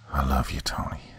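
unvoiced dying whisper; a faint last whisper to Tony. A narrator's reading. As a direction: breathe the last words out. Dying friend's last words.

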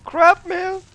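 very high, loud yelp; startled. Real, not acted. Player scared by the game, startled. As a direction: cry out in sudden fright.

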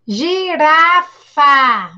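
slow, loud clapped syllables; slow, loud teacher demo. Shown as a demonstration. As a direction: stretch out gi-ra-fa for the class. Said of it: "Vamos lá".

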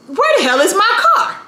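high-pitched disbelieving question, rising at the end; incredulous alarm. Retold or imitated. Woman pressing her key button in vain, incredulous alarm. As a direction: escalate into comic panic.

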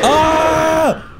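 sudden high scream. Real, not acted. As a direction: shriek in fright. Jump scare in horror map.

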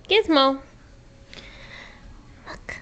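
ordinary talk, nothing marked

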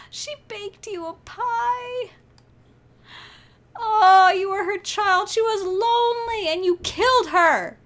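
high, loud, drawn-out lament; tearful wailing. Real, not acted. Player scolds self for killing her, tearful high wail. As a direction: wail through tears, stretching the ends.

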